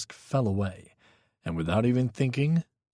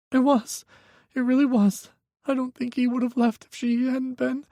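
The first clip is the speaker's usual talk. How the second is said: put-on high, tense young voice; a frightened young man pleading on the phone; young man, scared; 3 dB louder than usual. A narrator's reading. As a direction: Micah telling Hawk his ex is dangerous, high and scared. Micah's voice.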